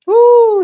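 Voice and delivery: loud, high whoop of delight; a big happy whoop at the screen; excited woo. Real, not acted. Whooping as a viewer joins the live stream.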